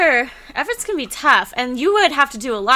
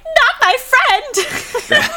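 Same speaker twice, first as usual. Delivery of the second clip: much higher, stretched and laughing wail; mock anguish; 14 semitones higher, much slower than usual. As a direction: grieve for a friend's character, half-joking. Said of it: "I was like".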